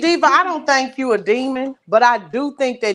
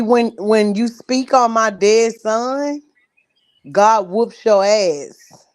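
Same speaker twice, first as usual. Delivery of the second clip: slow, preacher-like threat; slow solemn warning; much slower than usual. Acted. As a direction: drag it out like a sermon warning. Solemn warning about God.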